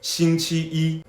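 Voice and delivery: steady 星期一, every beat the same; 星期一 drilled evenly; even first tones. Shown as a demonstration. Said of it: "both first tones".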